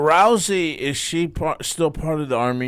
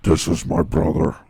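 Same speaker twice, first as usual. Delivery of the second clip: creepy podcast host; 3 semitones higher than usual. Retold or imitated. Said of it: "Even the way they introduce each other".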